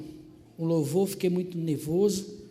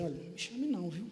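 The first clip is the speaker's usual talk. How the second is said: muttered warning; 10 dB quieter, faster than usual. Retold or imitated. Grumpy aunt warning her niece not to call her, quietly. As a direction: warn her under your breath.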